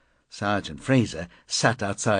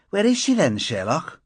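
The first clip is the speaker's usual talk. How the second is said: sarcastic challenge; 9 semitones higher, 4 dB louder than usual. Acted. Fraser mocking Dunford sharply.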